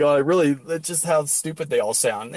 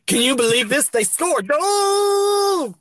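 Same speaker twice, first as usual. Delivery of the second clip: rising to a much higher astonished shout; mock amazed announcer; 8 semitones higher, slower than usual. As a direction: act like you've never seen a goal. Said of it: "He's like".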